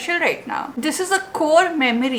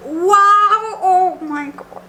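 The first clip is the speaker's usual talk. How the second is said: amazed; 5 semitones higher, 4 dB louder, much slower than usual. Real, not acted. Admiring a bookmark.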